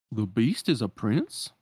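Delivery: slow, dopey puzzled voice; a slow goofy dog asking. Acted.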